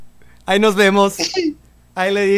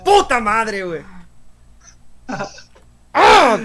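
frustrated yell; slower than usual. Real, not acted. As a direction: explode at losing. ¡Qué coraje!.

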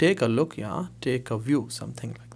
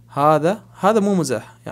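emphatic drill; 4 semitones higher, 6 dB louder than usual. Shown as a demonstration.

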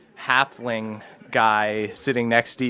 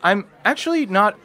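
higher, stammering halfling scoutmaster; much higher, jittery young voice; nervous halfling; 7 semitones higher than usual. Acted. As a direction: fumble through an introduction.

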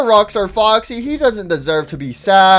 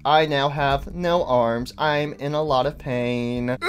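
low, soft, subdued apology; low-voiced suffering henchman; pained servant; 5 semitones lower, 8 dB quieter than usual. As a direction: report the injury meekly and wearily. Indo apologizing, arms torn off.

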